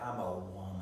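a light imitation of his super Southern accent; mock Southern drawl. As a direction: tease him with a hammed-up Southern woman line. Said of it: "you said it like Lily Tomlin on Nine to Five".

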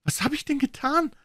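very high stricken question; high anguished plea; desperate question. A narrator's reading. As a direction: plead in disbelief. Condemned man asks guard.

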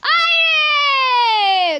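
long, loud, high wail; anguished yell. Real, not acted. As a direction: drag out a falling cry of dismay. Falls and dies in game. (लंबी हताश चीख)

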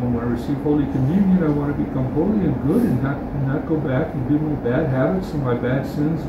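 usual speaking voice

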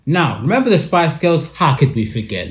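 ordinary talk

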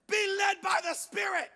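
high-pitched mock holler; mom calling back. Mom calls back from her bedroom, high mock yell. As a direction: call it out high to another room. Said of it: "she'd yell to us from her bedroom".

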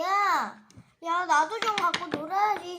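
loud protest, stress on 좀; angry shout. Pretend. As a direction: yell at the greedy friend, punching 좀. 인형끼리 장난감 두고 다툼. (장난감 뺏긴 친구에게 화내며 소리침)

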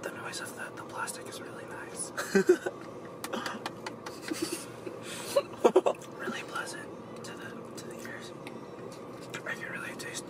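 soft whispered ASMR voice. Shown as a demonstration. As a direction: whisper close to the mic. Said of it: "A S M R mukbang".

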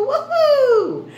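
high, loud party whoop; excited cheer. Acted. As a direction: cheer like the disco just peaked. Cheer after the chant.

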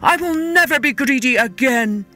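earnest, anguished king; calling out, pleading. A narrator's reading. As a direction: call your promise up to the gods. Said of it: "he called to the gods".

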